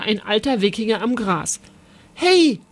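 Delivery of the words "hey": high, loud hail; bright-pitched storybook shout; calling out. A narrator's reading. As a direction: call to get someone's attention. Boy hails old man by river.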